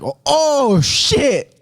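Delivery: high, swooping shock, re-enacted; alarmed exclamation. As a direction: act out a startled reaction to a charge. His alarmed reaction to bluff charge.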